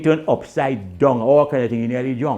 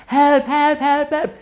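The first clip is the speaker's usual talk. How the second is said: high, loud yelling for help; hollering help from far out in the sea; mock cry for help; 6 dB louder, slower than usual. Drowning friend bawling for help from the water. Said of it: "He bawling".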